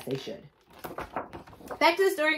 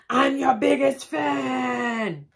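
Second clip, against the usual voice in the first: high, slow, louder declaration; fan confession; 9 semitones higher, 6 dB louder, much slower than usual. Acted. Santa telling the reindeer, slow and high. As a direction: proclaim it proudly.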